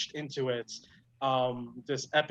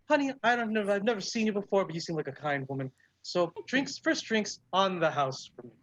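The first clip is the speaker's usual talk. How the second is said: higher put-on hostess voice; kindly elderly dwarf barkeep; 6 semitones higher than usual. Dwarf bartender treating a newcomer to drinks, kindly. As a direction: play a generous old dwarf woman.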